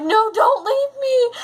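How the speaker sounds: high, drawn-out mock wail; desperate plea. Gamer to vanishing ghost, desperate mock-crying plea. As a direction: beg her to stay, voice shooting up.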